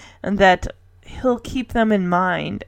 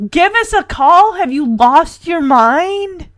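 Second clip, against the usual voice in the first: high, loud echoing question, slowing down; incredulous outrage; 7 semitones higher, 4 dB louder, slower than usual. Retold or imitated. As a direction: throw the words back in disbelief. Kate throws his words back in disbelief.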